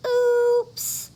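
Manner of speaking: high, loud embarrassed oops. Acted. Froggy caught by the principal, high oops. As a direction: caught red-faced.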